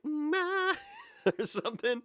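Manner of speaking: goofy high "Nah" with a laugh; silly vocal ad-lib. Shown as a demonstration. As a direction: throw in a silly yelp. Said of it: "doing something silly like".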